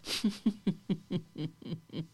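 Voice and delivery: quiet breathy giggle growing; soft laugh. Shown as a demonstration. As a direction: let a soft laugh slip out. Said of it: "get a little bit louder".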